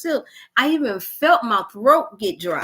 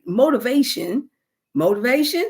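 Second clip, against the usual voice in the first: normal talking voice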